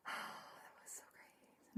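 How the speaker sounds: slow, breathy whisper. Real, not acted. Fan reviewing the episode, whispering to the camera. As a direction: let it fall to a slow whisper. Whispered aside after gushing.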